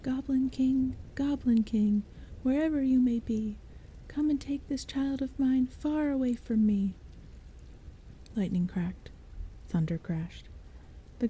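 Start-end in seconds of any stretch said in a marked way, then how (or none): 0.0-6.9 dramatic mock invocation; intoning. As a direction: intone it like a ritual spell. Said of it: "She started to intone".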